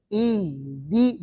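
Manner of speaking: ای، دی said low and very soft, breath carried through; quiet ای، دی with breath and voice kept flowing; soft letter demo. Shown as a demonstration. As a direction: breathe out ای، دی softly with the voice running on. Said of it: "وہاں پتہ چلے گا".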